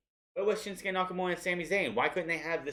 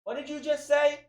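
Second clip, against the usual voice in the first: mock outrage; 12 semitones higher, 4 dB louder than usual. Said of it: "Brock Lesnar's like".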